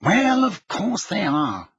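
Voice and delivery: slow, high, weary exasperation; sighing. A narrator's reading. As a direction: draw it out as a weary given. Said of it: "Fudge sighed".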